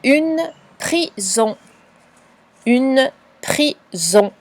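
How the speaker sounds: each word spoken very slowly and clearly; drawn-out dictation voice. Shown as a demonstration. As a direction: dictate it slowly for a child to write down. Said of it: "Le mot suivant".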